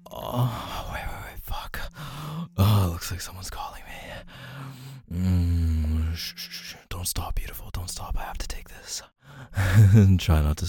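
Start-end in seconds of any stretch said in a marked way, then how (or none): 0.9-9.0 urgent soft whisper; hushed whisper. Acted. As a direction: hush her before the phone call. Oh, looks like someone's calling me.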